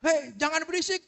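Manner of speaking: high-pitched mock classroom shout; scolding teacher. Teacher to a noisy class, scolding. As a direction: call a noisy class to order. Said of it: "Di kelas juga sama".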